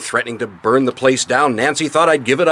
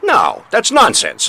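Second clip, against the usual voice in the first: indignant denial; 9 semitones higher, 4 dB louder, slower than usual. Acted. Husband denies the accusation.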